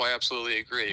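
usual speaking voice

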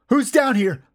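high, loud challenge. A narrator's reading. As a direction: call out a demand into the dark. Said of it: "I finally demanded, finally raising my voice".

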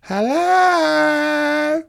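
long high sung-out call. Acted. Goldilocks calls again into the empty house, long and high. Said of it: "she called".

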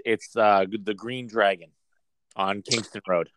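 ordinary talk

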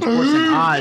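hippo voice on hoarse and odd; a hammed-up hippo call; deep bubbly hippo. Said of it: "that's the sound of a horny hippopotamus".

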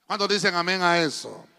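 loud rousing call to the crowd; preacher's call. Real, not acted. Preacher calls the congregation to say amen, loud. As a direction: shout it out to fire up the church.

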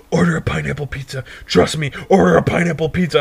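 quick, pushy imitation of a buddy; urgent stoned pestering. Buddy pushing him to try pineapple pizza, fast and insistent. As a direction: rush it out insistently. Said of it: "And he goes".

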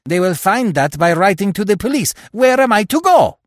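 rising rage. A narrator's reading. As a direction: let the fury build until he's yelling. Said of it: "demanded Terry in sudden rage".

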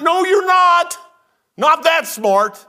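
loud retort. Acted. Pastor shutting down a smug churchgoer, loud high retort. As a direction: shut him down hard.

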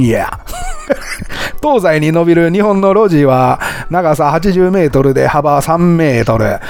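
amateur, badly faked Kansai accent, amused; odd Kansai accent. Host reads a chatbot's text to listeners, odd Kansai accent. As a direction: read it out in a clumsy put-on Kansai dialect. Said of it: "変な関西弁になってますけど".

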